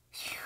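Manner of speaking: long blown-out breath; steadying exhale. Real, not acted. Mom composing herself before explaining, steadying exhale. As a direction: blow out a breath to hold back tears.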